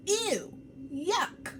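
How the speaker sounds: high, squealed disgust at the food; childish high-pitched yuck; grossed-out ew. Acted. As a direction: recoil like a kid who smelled something nasty. Kid recoiling from friend's sandwich.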